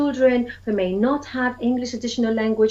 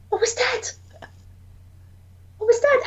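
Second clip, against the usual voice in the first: much higher teasing question; 13 semitones higher than usual. Shown as a demonstration. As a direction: tease the kids with suspense. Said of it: "I tease them with it".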